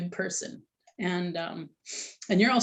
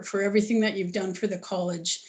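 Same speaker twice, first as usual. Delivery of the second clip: ordinary talk, nothing marked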